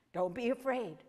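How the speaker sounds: usual speaking voice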